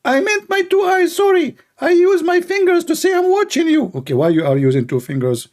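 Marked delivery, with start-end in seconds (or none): 0.0-3.9 much higher mock preacher cursing; squeaky parody of an angry preacher; Ali Dawa. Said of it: "Ali Dawa".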